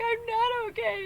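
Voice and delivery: high, slow, hammed-up crying wail; mock crying wail. Ron sobs into the phone in a hammed-up crying wail. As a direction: wail it like a big man falling apart. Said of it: "he's screaming and he's crying".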